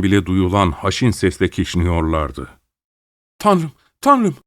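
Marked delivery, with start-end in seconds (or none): 3.4-4.4 a high, piercing cry to God, much higher; Amy crying out in terror, much higher in pitch; terrified cry. A narrator's reading. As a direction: scream it out in sudden panic. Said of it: "diye bağırdı Amy".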